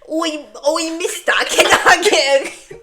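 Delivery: hammed-up high British child; mock British urchin, loud and drawn out; exaggerated Mary Poppins-style British accent. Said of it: "was giving Mary Poppins".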